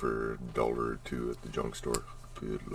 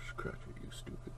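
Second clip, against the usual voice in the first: whispering; 9 dB quieter than usual. Real, not acted. Mutters at camera.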